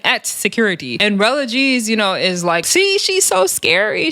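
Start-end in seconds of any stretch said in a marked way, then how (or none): 2.7-4.1 high, slow sneering taunt; mock taunt. Rella G mocking Erica Raven as scared, high slow taunt.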